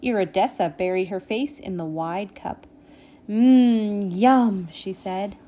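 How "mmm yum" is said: relishing, louder hum of pleasure; savouring. A narrator's reading. Iridessa tastes hot chocolate, savouring. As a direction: linger on it like a delicious sip.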